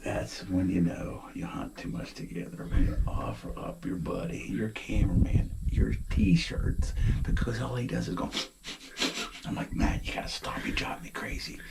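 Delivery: hushed whisper in the deer blind. Real, not acted. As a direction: keep it to a whisper so deer won't hear.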